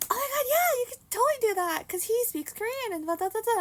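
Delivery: high, loud eager friend impression; excited encouragement. Lex urging her to talk to his friend, excited. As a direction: egg a friend on, gushing.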